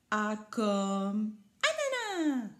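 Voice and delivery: slow, drawn-out proud little voice; proud child. A narrator's reading. Rikili proudly naming a fruit, slow and drawn out. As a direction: announce it proudly, slowly. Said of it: "crie fièrement Rikili".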